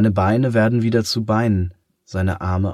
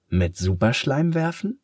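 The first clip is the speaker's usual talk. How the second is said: eager question; 6 semitones higher than usual. A narrator's reading. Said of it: "fragt Matt aufgeregt".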